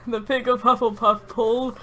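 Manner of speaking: ordinary talk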